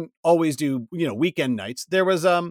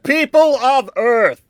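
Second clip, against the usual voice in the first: slow, grand, booming high voice; booming arrogant alien overlord; 7 semitones higher, slower than usual. Acted. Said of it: "can Pizza Express Man say hello".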